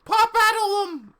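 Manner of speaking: very high, loud screeched spell; wrong character voice. A narrator's reading. Casting a spell in the wrong voice, squealed. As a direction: yell the spell in a squeal far above your own voice. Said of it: "that's, that's wrong voice".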